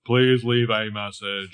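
slow, robotic voicemail voice; automated phone message. Acted. Phone message for the caller, in an automated voice. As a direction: put on an automated answering-machine voice. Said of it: "She gets an automated message".

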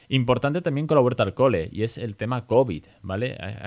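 normal talking voice

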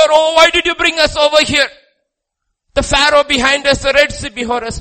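high, loud wail of complaint; fearful outcry. Acted. Israelites trapped at the Red Sea, hammed-up fearful cry. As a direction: cry out like a trapped, frightened crowd. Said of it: "They will cry out in fear".

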